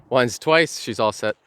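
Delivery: loud cheerful announcement; excited. Real, not acted. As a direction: call it out proudly. Knot finished, says it loudly.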